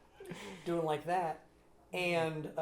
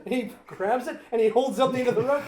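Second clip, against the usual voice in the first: usual speaking voice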